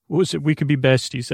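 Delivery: usual speaking voice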